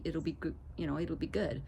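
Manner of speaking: ordinary delivery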